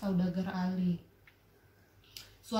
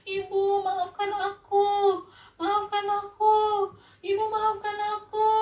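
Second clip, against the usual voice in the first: begging forgiveness; 11 semitones higher, 5 dB louder than usual. Acted. Son begging his mother as he turns to stone, high wail. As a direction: sing out your regret, high and drawn. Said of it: "Dia bilang".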